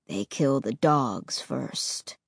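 deep, slow and cold; low, flat tone. Acted. Ray voiced low.

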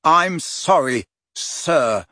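slow apology, "sir" set apart pointedly; stress on sir. A narrator's reading. Harry apologises to Hagrid, stress on "sir". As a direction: lean on "sir" after a drawn-out "I'm sorry". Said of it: "emphasizing the last word".

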